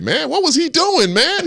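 high, amused voice; opponent's amazement. Retold or imitated. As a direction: marvel at the runner. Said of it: "he was like".

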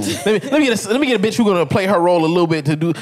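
imitating a man's thinking.

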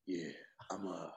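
creepy hushed hesitation at daycare pickup; inappropriate whisper. Pretend. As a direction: murmur it very softly, like a suspicious stranger. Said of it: "so inappropriate with that whisper".

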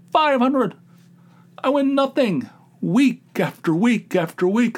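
high-pitched, indignant rant; outraged disbelief. Real, not acted. Losing gambler venting about his boy's win, indignant. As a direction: protest the unfairness, voice jumping up.

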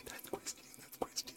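urgent whisper to his wife; excited whisper. Retold or imitated. Spotting the wrestler in the hotel lobby, whispering to his wife. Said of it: "I was like".

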